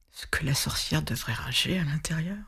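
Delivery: a scared whisper about the witch; hushed whisper. A narrator's reading. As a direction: breathe it like a secret. Whispered suspense aside.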